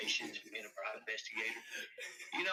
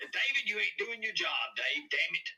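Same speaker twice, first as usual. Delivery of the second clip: mock complaint; 7 semitones higher, 6 dB louder than usual. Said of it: "I didn't know who she messaged".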